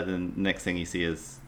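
ordinary talk

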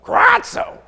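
very high, loud raven squawk; raven screech. Shown as a demonstration. Preacher showing the congregation how a raven says it, loud screech. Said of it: "if a raven were to say this kratzo word".

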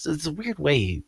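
ordinary talk, nothing marked

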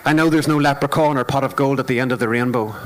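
steady rhythmic poetry performance; spoken word poem. Acted. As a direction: perform it like a stage poem, measured and deliberate.

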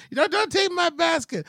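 very high imitated protest; mock old lady. Old lady guarding her basket, high mock voice. Old lady's reply in quarrel.

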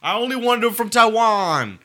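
high, loud whiny complaint; mock whiny trafficker. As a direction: whine it like a fussy buyer.

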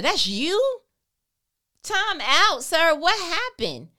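loud, high disbelieving roast; mock the prophet with loud amazement; preacher teasing Elijah loudly to the crowd; incredulous teasing. Real, not acted.

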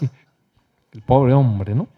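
ordinary delivery

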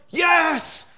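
put-on high loud kid's yelp of yes; eager child yes. Kids answer dad's chocolate offer, high eager yelp. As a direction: shout it like a delighted kid.

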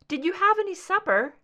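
high, loud call to the next room. Acted. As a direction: call it out to him across the house. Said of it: "she called to Mulder".

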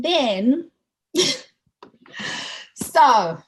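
laughing. Real, not acted. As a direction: laugh before getting the topic out. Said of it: "I'm laughing because".

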